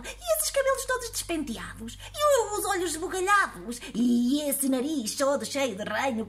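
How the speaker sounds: put-on high, prying old lady voice; nosy old woman. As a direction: pester him like a nosy granny. Old woman rattles off questions.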